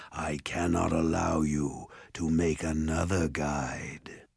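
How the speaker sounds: slow, husky ogre voice; Mulgarath. A narrator's reading. Ogre refusing to free Jared, slow menace. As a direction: drag it out with menace, sinking low at the end. Ogre explains his threat.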